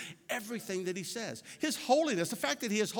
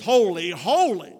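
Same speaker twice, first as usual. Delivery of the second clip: HOLY punched loud, each one set apart; emphatic, loud "holy, holy"; stress on HOLY; 10 dB louder, slower than usual. Real, not acted. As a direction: hit each "holy" hard, falling away. Repeats the word to stress it.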